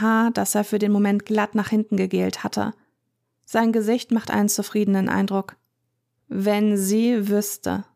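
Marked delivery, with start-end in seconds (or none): 6.3-7.8 very slow, drawn-out thought; inner thought. Acted. Michael thinking smugly to himself, very slow. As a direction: let the thought crawl out slowly. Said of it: "denkt er".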